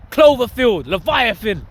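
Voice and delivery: ordinary talk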